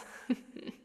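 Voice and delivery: low-key breathy snicker; sinister chuckle. Acted. Krampus amused by captive sinner.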